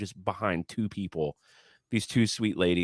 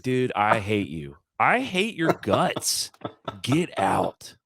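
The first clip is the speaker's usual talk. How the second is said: pretend angry; 3 semitones higher, slower than usual. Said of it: "I didn't say that, of course".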